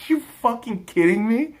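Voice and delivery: ordinary talk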